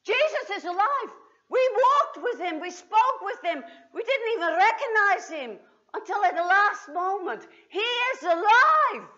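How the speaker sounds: loud, high and laughing with excitement; tumbling out the news, laughing; breathless laughing joy; overjoyed. Acted. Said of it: "couldn't contain themselves".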